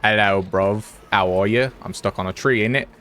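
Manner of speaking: lightly hammed-up British geezer; playful fake British accent, bruv and innit. Shown as a demonstration. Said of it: "I can do British".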